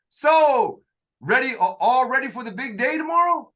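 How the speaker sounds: sing-song taunt pretending to be friendly; mock cheery voice. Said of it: "In a real Joy's voice".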